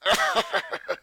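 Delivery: high, breathy bitter scoff; short bitter laugh; dark laugh. Acted. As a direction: laugh darkly at her. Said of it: "gave a dark laugh".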